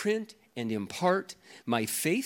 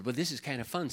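ordinary talk

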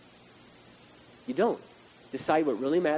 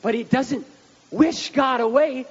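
preaching; 10 semitones higher, 8 dB louder, slower than usual. Acted. Preacher at full force.

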